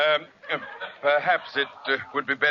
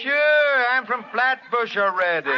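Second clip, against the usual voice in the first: put-on high, loud Brooklyn accent; 9 semitones higher, 3 dB louder than usual. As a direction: burst out in broad Brooklynese. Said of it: "since I came from Brooklyn myself".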